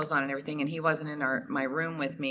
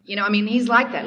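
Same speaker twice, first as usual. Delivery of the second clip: ordinary talk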